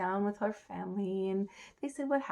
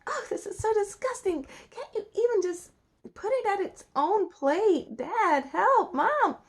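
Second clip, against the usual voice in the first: high, louder whine to parents; hammed-up grossed-out princess; disgusted princess; 11 semitones higher, 6 dB louder than usual. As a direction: wail for help in mock disgust.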